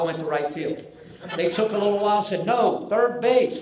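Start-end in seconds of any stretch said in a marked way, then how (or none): 2.4-3.6 drawn-out, louder "No, third base"; slow firm correction. Retold or imitated. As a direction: spell it out slowly for a slow kid. Coach repeats order.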